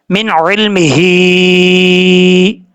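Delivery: slow, melodic मिन इल्मिही; tajweed chanting; Quranic recitation. Acted. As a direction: chant it out slowly.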